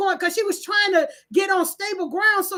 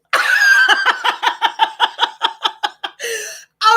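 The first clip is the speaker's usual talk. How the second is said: loud delighted laugh; 7 semitones higher, 7 dB louder than usual. Real, not acted. Delighted at new nickname.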